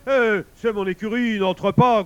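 loud, high protest; growling bear. A narrator's reading. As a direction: bellow to bar the way. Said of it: "gronda l'ours". (ours qui gronde, fort et indigné)

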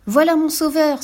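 light up with naive glee; naive delight. A narrator's reading. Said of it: "se réjouit-elle naïvement".

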